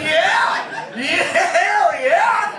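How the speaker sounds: high, slow, drawn-out laughing yell; hyped cheer. Retold or imitated. To the little nephew about popsicles, a drawn-out laughing cheer. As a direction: whoop it out like a crazed fan.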